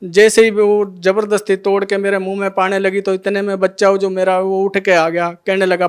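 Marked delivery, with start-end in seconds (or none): none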